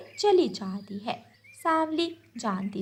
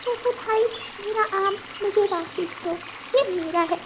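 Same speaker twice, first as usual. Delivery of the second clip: put-on high child's voice; pleading little girl; 9 semitones higher than usual. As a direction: demand her mango back. Little bird demands mango back.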